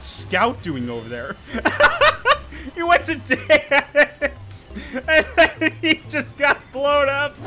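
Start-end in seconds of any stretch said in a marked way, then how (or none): 1.4-2.7 high, loud burst of laughter. Real, not acted. Player cracking up at a scout, high laugh. 2.7-7.3 high, loud, drawn-out words through laughter; laughing. Real, not acted. Player laughing at a scout blown up, laughing hard.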